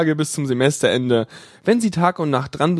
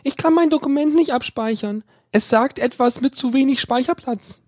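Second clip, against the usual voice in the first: female caller; 9 semitones higher than usual. A narrator's reading. Female caller voiced by narrator.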